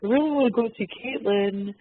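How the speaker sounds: put-on high, slow smitten boy voice; bashful young boy. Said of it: "this cute young Canadian boy that's all bashful".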